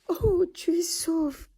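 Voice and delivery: overflowing relief of a mother; tears of joy. A narrator's reading. Mother finding her lost daughter, joyful tearful cry. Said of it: "elle explosa en larmes de joie".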